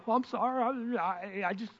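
soft, stammering mock apology; acted fluster, quietly tripping over words. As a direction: stumble over an awkward apology. Acting flustered reply to someone crying.

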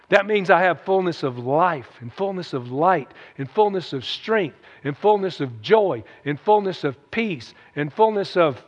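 ordinary delivery

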